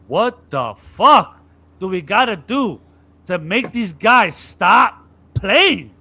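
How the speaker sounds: loud, slow, exasperated; frustrated locker room rant. Retold or imitated. Spurs players at halftime, loud slow exasperation. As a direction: spell out each word in disbelief. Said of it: "sit in the locker room and go".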